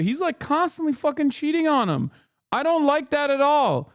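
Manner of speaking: high-pitched, worked-up protest; indignant. Real, not acted. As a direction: let outrage drive the pitch way up. Indignant about a character cheating.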